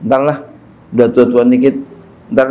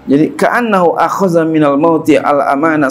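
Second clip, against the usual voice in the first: usual speaking voice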